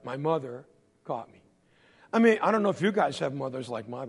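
ordinary talk, nothing marked

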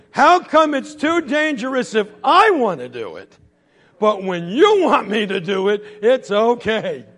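put-on high, loud kid's objection; hammed-up argument, dragging out the last words; indignant protest. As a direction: protest loudly at the unfairness. Boy protests mother's double standard.

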